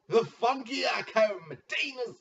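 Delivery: drawn-out ring announcer call, high and loud; hype announcer intro. Acted. Commissioner announcing a fantasy division member. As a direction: announce him like a boxing emcee.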